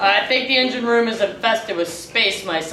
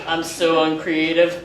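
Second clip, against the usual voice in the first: usual speaking voice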